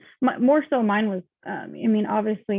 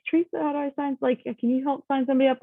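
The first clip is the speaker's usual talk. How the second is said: pleading question; 6 semitones higher, faster than usual. Retold or imitated. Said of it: "every time I'm like".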